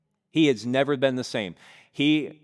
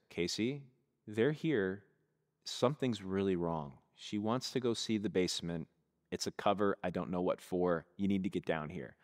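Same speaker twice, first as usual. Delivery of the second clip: low-volume, tense, softer voice; urgent hushed warning; 3 semitones lower, 7 dB quieter than usual. Retold or imitated. Husband warning his wife about the strange visitors, hushed and tense. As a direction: keep it down so the guests won't hear.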